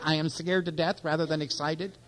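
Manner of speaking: ordinary talk, nothing marked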